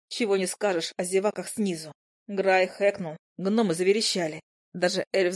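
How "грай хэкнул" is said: ordinary delivery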